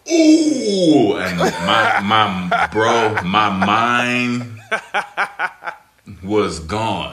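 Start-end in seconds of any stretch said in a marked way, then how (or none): 0.1-1.2 high, loud shocked ooh; like you just got burned; stunned ooh. Retold or imitated. Rookie hearing the mama's boy insult, loud high ooh. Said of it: "And I was like".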